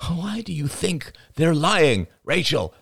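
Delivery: slow, high, exasperated question; rising voice. A narrator's reading. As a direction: challenge her with mounting exasperation. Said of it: "Arlo's voice rose".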